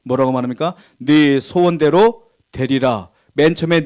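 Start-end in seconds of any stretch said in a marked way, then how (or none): 1.0-3.1 slow solemn blessing. Acted. Preacher quotes Jesus' blessing.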